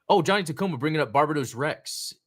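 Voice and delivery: ordinary talk, nothing marked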